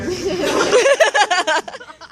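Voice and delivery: high giggling burst; high laugh. Real, not acted. Laughs retelling mom's reaction.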